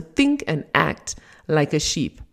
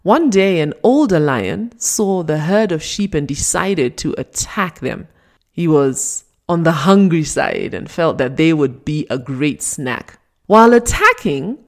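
ordinary talk, nothing marked

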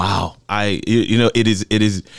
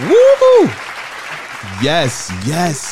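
loud, high whoop; cheering; 8 semitones higher, 5 dB louder, much slower than usual. Real, not acted. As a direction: whoop with joy. Cheering after award announced.

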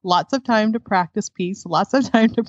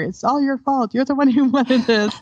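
mock blaming; 5 semitones higher than usual. Imagined blame from husband.

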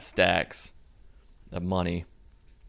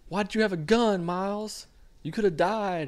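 high-pitched imitation of an upset girlfriend; mock tearful; 12 semitones higher than usual. Girlfriend upset he could have been killed, high mock pleading. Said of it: "crying and stuff".

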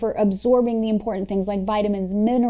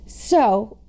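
usual speaking voice

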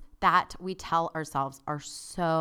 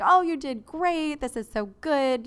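much higher, louder, slowed mock praise for a nervous beginner; cheery dive instructor; 9 semitones higher, 7 dB louder than usual. Dive instructor praising the scared diver, high cheery mock voice. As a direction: play the upbeat instructor gushing over her. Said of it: "he's like".

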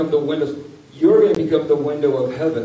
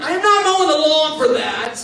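put-on higher, laughing kid's refusal; indignant kid; 11 semitones higher than usual. Teen refusing to mow the lawn, indignant. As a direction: toss off the chore like a sulky teen.

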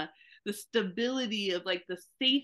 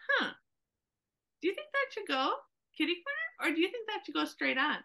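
much higher, tentative questioning voice; hesitant husband; 9 semitones higher, faster than usual. Retold or imitated. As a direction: fish for the answer you want. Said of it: "the other guy says".

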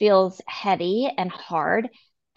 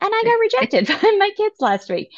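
laughing; 11 semitones higher, 6 dB louder than usual. Real, not acted. Laughing about kids rejecting recipe.

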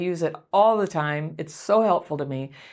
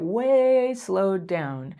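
very slow, drawn-out words; 3 semitones higher, much slower than usual. Shown as a demonstration. Showing listeners how to slow the interaction, very slowly. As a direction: model the slowness you describe. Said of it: "slow down the interaction".

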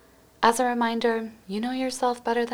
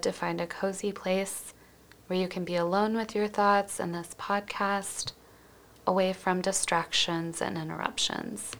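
usual speaking voice

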